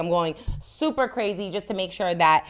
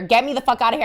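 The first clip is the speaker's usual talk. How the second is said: higher, louder cry to get out; panicked plea; 6 semitones higher, 5 dB louder, faster than usual. Retold or imitated. Stuck on the plane, panicked louder outburst. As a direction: beg to get off, rising. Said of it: "I'm like".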